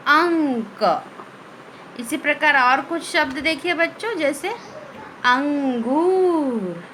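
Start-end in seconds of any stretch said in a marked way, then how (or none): 0.1-1.1 अंक drawn out slowly, the nasal ं held through the nose; slow nasal anusvara. Shown as a demonstration. Teacher models a word for her pupils, slow and nasal. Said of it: "तो इनको कैसे पढ़ेंगे?". 5.2-6.8 अंगूर drawn out slowly, the nasal ं sounded through the nose; slow nasal demo. Shown as a demonstration. Teacher shows pupils how अंगूर is read, slow and nasal. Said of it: "इसका उच्चारण कैसे हो रहा है आपको ध्यान देना है".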